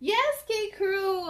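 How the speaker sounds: high, slow cheer to the crew; excited greeting. Real, not acted. Host cheering her young viewers after the book, excited call.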